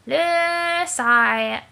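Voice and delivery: a hammed-up French sigh. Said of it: "the Frenchest of possible sighs".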